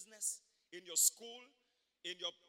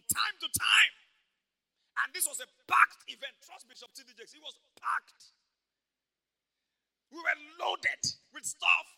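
loud, rising, slow preacher's cry; preaching at full force; 7 semitones higher, 15 dB louder, slower than usual. Real, not acted. Preacher shouting to the congregation about his burning ulcer. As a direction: drive it home to the congregation, full voice.

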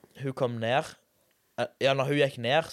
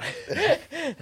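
high burst of laughter. Real, not acted. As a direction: laugh out at his own blunder. Laughing at own embarrassing story.

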